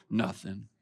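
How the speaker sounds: a curt, sulky "Nothing". Acted. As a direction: answer in a low, clipped sulk. Passive aggressive sulking reply.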